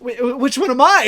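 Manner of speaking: acted-out confused question. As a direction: play a flustered, mixed-up hero. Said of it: "kinda confused".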